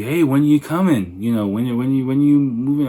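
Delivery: imitating the Chinese coworker's giddy questions. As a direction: pester him happily about moving in. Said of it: "he seemed really giddy".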